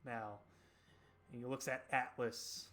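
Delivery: slow, hushed scene setting; game master narration. Acted. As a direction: let it sink softly, with long pauses.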